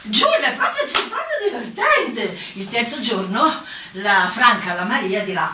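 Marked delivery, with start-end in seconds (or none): 0.0-2.4 high-pitched mock approval; second-day gossips. Neighbours discussing Giulia on the second day, high mock approval. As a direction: imitate neighbours commenting, pitched well up. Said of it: "il secondo giorno diranno".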